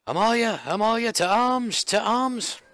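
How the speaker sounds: high-pitched alarm call; call to arms. Acted. Guard rousing comrades to fight, high urgent call. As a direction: cry out the alarm, high and insistent.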